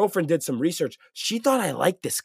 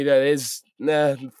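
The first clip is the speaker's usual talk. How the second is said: usual speaking voice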